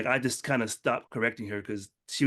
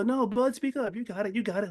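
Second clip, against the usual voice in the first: much higher, cheery coaching dad; dad encouraging son; 10 semitones higher than usual. Retold or imitated. Dad urging shy son to order, encouraging high voice. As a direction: push the shy kid on gently. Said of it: "And he go".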